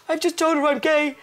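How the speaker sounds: high, loud retold wail; mock distraught confession; hammed-up tearful blurting. Crying daughter telling her dad, mock tearful. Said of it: "I was crying. I was in tears".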